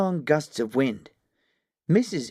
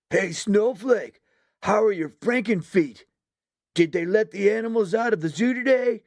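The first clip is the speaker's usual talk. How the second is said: higher, mocking bully calling across; taunting shout; 7 semitones higher than usual. A narrator's reading. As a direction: jeer at Snowflake across the track. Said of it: "Cannonball shouted over to her".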